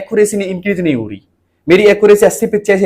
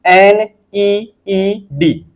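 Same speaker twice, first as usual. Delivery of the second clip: very slow, letter by letter; slow spelling; much slower than usual. Shown as a demonstration. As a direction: drag out each letter of need. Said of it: "तो नीड कैसे पढ़ेंगे?".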